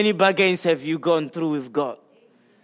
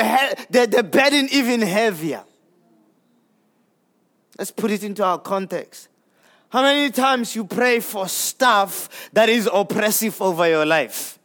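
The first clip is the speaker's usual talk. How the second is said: ordinary talk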